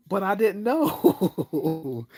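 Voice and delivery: high, slow, laughing plea of innocence; drawn-out laughing excuse; laughing protest. Retold or imitated. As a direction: whine it out through a laugh. Retold own protest to girl.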